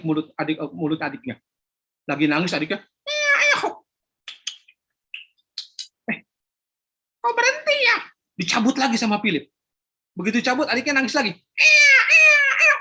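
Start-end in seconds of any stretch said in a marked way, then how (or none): 3.1-8.0 put-on high childish voice; a little boy at the shop counter; young boy. Philip speaking to shopkeeper Pak Joko, high child voice. 11.3-12.8 high loud hushing; boy hushing baby. Acted. Philip hushing his crying little brother, high loud child voice.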